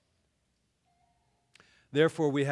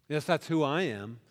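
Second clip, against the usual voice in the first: ordinary delivery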